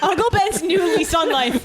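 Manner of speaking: laughing through the line; giggling as you say it. Real, not acted. Laughing riff on brand name.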